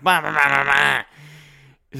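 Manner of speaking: loud nonsense alien gibberish; alien babble. Retold or imitated. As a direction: blurt out alien sounds. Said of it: "It's like".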